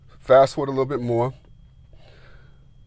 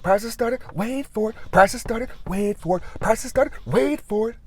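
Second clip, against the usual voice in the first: announcer on the store speakers; announcer teasing prices; 6 semitones higher than usual. Retold or imitated. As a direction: build suspense like an ad announcer. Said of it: "he goes".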